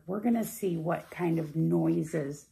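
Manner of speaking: ordinary delivery, nothing marked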